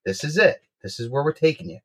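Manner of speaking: ordinary talk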